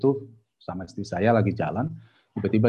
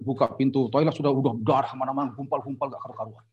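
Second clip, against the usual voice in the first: ordinary delivery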